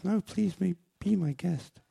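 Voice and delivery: put-on high falsetto young woman; girl's voice. The girl invites the man in high falsetto. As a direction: lift into a squeaky girl voice.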